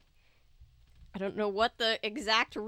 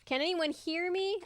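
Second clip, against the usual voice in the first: higher call for help; shouting louder; 8 semitones higher than usual. A narrator's reading. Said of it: "forcing myself to shout louder".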